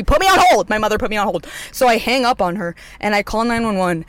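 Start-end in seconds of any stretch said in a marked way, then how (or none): 0.0-0.7 loud, high-pitched burst of frustration; retold exasperation. Daughter complaining about mom's hold, retold exasperation.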